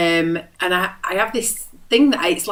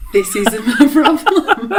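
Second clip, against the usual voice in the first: slow, louder, laughing through it; laughing retort; 5 semitones higher, 7 dB louder, slower than usual. Retold or imitated. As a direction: laugh as you answer back. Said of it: "And I said".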